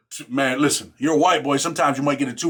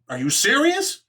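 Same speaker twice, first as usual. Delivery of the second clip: high incredulous question; pitched-up shock at the threat; disbelief; 10 semitones higher, slower than usual. Retold or imitated. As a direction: sound stunned he'd say it.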